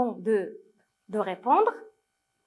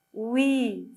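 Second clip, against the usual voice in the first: ordinary delivery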